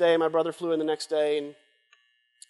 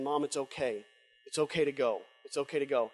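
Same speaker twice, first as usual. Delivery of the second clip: tender release; 4 semitones lower, 7 dB quieter than usual. Retold or imitated. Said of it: "he kept saying".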